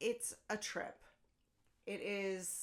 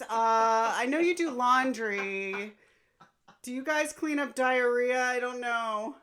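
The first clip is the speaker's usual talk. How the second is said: louder, slow mock phone call; hammed-up husband calling a laundry service; 4 semitones higher, 10 dB louder than usual. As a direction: play the hapless husband asking for help.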